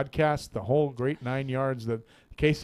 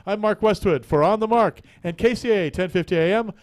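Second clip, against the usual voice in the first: louder announcer sign-off; radio sign-off; 4 semitones higher, 5 dB louder than usual. Acted. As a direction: close the show with a flourish.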